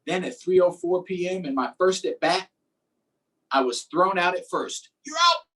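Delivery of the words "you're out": high, loud umpire's shout; umpire's call. Retold or imitated. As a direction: bark it out like a baseball umpire.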